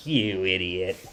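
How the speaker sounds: low, soft muttered insult; mock disgusted mom, under the breath; imitated scolding mom. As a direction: mutter it low like a fed-up mother. Retold mother scolding her son.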